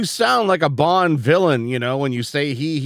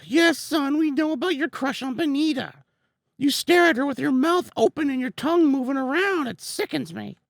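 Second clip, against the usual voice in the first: high stern father voice; 9 semitones higher, 4 dB quieter than usual. Acted. Voicing the father character.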